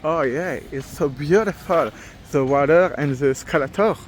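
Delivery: English said with a heavy French accent, mocking himself; thick French accent. Shown as a demonstration. Vlogger mocks his own English with a thick French accent. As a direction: ham up your bad French-accented English. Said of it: "j'ai un accent de merde".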